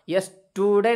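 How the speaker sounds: यस्टरडे with a long "oo" in the middle, "yes-too-day"; wrong U vowel. Shown as a demonstration. Teacher warning learners off a mispronunciation, यस्टरडे with an "oo" vowel. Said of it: "यू को हम पेश की आवाज़ नहीं निकालेंगे".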